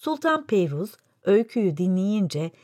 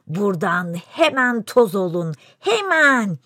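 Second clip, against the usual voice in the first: eerie shout; 3 dB louder than usual. Acted. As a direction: bellow the order in the strange voice. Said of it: "diye bağırmış Behruz".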